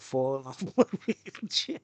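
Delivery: laughter breaking into the words; laughing. Real, not acted. Gamer joking about falling off his wheelchair, laughing. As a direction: crack up while finishing the sentence.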